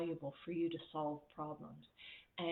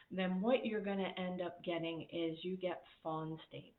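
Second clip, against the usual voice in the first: ordinary talk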